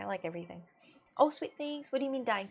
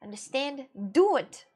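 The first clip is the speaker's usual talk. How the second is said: exasperated pleading to stop; emphatic don'ts; 3 semitones higher, 6 dB louder, slower than usual. Real, not acted. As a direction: scold firmly, word by word. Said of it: "fucking don't do this".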